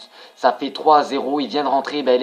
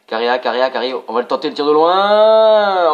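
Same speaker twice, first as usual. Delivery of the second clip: building hype; 3 semitones higher, 10 dB louder than usual. Real, not acted. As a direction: stretch out the long shot. Long shot attempt.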